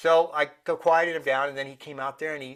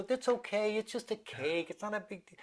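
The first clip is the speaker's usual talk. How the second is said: high, soft comforting voice, fading quieter; gentle reassurance; 6 semitones higher, 7 dB quieter than usual. Acted. Parent to a child crying over a dropped cake, soothing. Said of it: "as they're crying".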